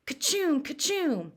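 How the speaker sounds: high hammed-up fake sneezes; mock sneezes. Joe fakes Billy's fit, hammed-up sneezes. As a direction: sneeze hard, twice.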